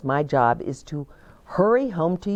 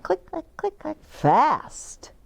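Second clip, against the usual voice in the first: shoe clicks; 10 semitones higher than usual. Acted. Running back shoes.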